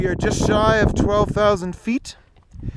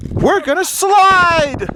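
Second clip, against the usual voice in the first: excited; 5 semitones higher, 4 dB louder, slower than usual. Real, not acted. Excited announcement of sliding down.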